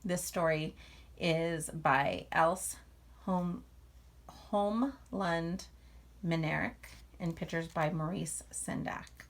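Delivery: lively, expressive read-aloud to a class; reading to children. A narrator's reading. Teacher reading a picture book to the class, lively read-aloud. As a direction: draw young listeners into the story.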